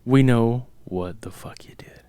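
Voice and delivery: slow, breath-only whisper; mock-menacing whisper. Co-host calling out the film's trick, slow mock whisper. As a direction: whisper it slowly as a playful threat.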